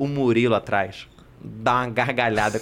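ordinary talk, nothing marked

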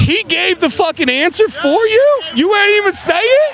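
can't believe he didn't say it; outraged disbelief at the friend; indignant disbelief. Real, not acted. Indignant at answer.

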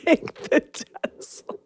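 quiet giggling through the question; laughing. Retold or imitated. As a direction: giggle it out sheepishly. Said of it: "I almost said something so stupid".

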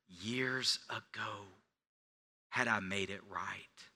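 breathy whispered aside; whispered regret. Acted. Pastor whispering his regret to the congregation. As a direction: let it fall to a whisper. Confessional regret at sermon end.

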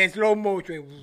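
drawn-out, low slow-motion narration. Acted. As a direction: stretch it like a slowed-down film moment. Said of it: "cámara lenta".

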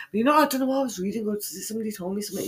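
ordinary talk

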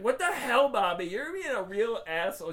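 loud, high indignant telling-off; mock scolding. Host voicing Allison telling off her boyfriend, mock scolding. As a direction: scold him in playful mock outrage. Acted quarrel, girlfriend scolding Bobby.

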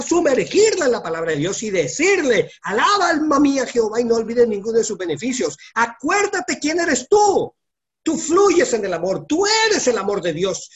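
más agudo y fuerte, predicando con fuerza; predicación encendida. Acted. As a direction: empuja cada frase como en un clímax de culto. (predicación ardiente, aguda y fuerte)

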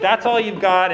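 loud, high jeer; mocking hyena taunt. Hyena taunts cub after his failed roar, mocking jeer. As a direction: belt out a scornful challenge. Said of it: "she's just like".

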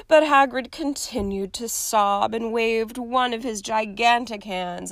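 normal talking voice

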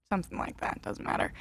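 a real muttered aside, dropping the point; whispered aside. Real, not acted. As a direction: toss it off under your breath. Dismissive aside to herself.

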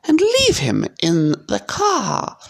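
high, slow aunt voice; slow, high-pitched afterthought; said slowly. A narrator's reading. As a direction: draw each word out, pitched high. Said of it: "said Aunt Petunia slowly".

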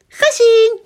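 put-on high, loud gleeful child's call; triumphant high-pitched shout. A narrator's reading. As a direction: sing it out at the top of the voice. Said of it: "roept Liekje".

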